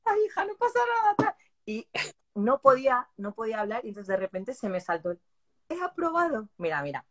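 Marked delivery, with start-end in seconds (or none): 0.0-1.3 high, soothing imitation of mom; mother trying to comfort her crying daughter; a mother's high consoling voice. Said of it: "mi madre igual, de". 5.7-6.5 blurting out. Retold or imitated. Blurting the news through tears.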